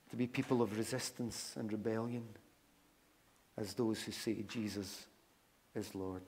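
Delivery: hushed pastoral prayer in short phrases. Real, not acted. Leads a prayer.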